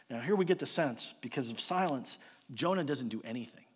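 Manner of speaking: ordinary delivery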